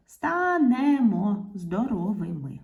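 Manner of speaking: measured syllables through "станемо здоровими"; dictation. Shown as a demonstration. Teacher dictating the finished sentence to pupils, syllable by syllable. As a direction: draw out "станемо" vowel by vowel.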